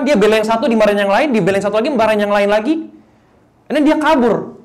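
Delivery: loud, high, driving sermon voice; a pastor's loud, fired-up call to the crowd; full-force preaching. Acted. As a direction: preach it out to the whole hall.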